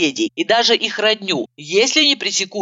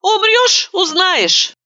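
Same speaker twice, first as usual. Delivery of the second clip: teasing promise; 12 semitones higher, much slower than usual. A narrator's reading. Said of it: "пообещала я".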